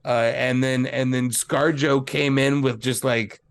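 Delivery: normal talking voice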